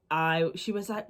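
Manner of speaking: ordinary talk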